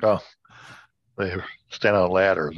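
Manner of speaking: ordinary delivery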